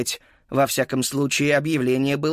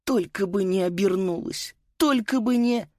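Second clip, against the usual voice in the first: high, anxious pleading; 7 semitones higher than usual. Acted. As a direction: beg under your breath, rising higher. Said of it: "умоляюще сложил руки".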